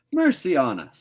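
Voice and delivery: high, loud startled cry; a loud, high gasp of surprise; astonished. A narrator's reading. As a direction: caught completely off guard. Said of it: "said astonished Marilla".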